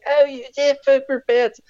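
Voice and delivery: very high, slow mock-aghast voice; imitating horrified cousin. Cousin reacts to chocolatey hand, high mock horror. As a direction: draw it out high, playing a disgusted kid.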